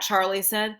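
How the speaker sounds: ordinary delivery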